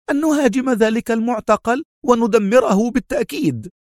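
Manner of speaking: high-pitched, furious-sounding insistence; exasperated cry. A narrator's reading. Mona demanding an attack on the prison, exclaiming furiously. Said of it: "في حنق هاتفة".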